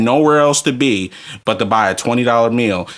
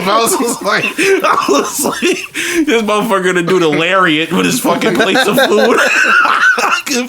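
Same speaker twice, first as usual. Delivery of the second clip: wheezing with laughter through the joke; laughing; 9 semitones higher than usual. Real, not acted. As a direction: quote forced out through high laughter. Laughing through his own quote.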